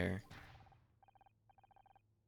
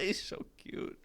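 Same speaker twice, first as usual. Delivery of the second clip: cute whisper; 6 semitones higher, 9 dB quieter, slower than usual. Real, not acted. Cooing over the dog Scout.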